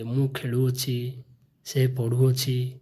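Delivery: slow, spelled-out teacher examples; slow, careful dictation of examples; slow example sentences. Shown as a demonstration. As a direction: read each example slowly for the class. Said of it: "ଏହିପରି ବାକ୍ୟ ଥାଏ".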